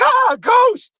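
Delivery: very high startled shriek; mock fright. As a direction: yelp in hammed-up terror. Retold fright at a car honk.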